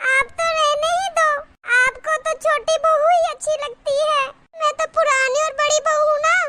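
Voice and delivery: high-pitched, hammed-up self-pity; wounded whining. Elder daughter-in-law claiming favouritism, wounded whining. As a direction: keep up a high plaintive protest.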